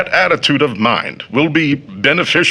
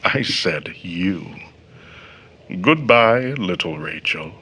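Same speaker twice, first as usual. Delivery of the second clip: low and soft, lingering on little Rachel; quiet, intimate goodbye; soft, lower voice meant only for her; tender parting; 6 semitones lower, 5 dB quieter than usual. Acted. John's parting words to Rachel.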